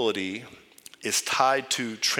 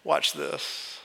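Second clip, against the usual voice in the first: calm Jesus; 7 dB quieter, much slower than usual. Retold or imitated. Jesus answering the worried preacher, softly and calmly. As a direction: answer gently with a knowing smirk. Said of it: "Jesus just smirks and just says".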